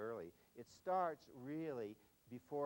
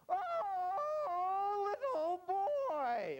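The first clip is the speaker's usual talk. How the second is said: mock agonized wail, very high and loud; hammed-up, drawn-out cry of anguish; wail of agony; 10 dB louder, much slower than usual. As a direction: wail it long and high, stricken with pity. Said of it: "It was this wail of agony".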